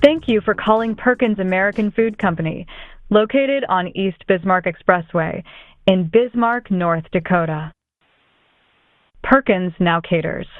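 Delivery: recorded automated phone greeting; calm, even, recorded menu read-out. Real, not acted.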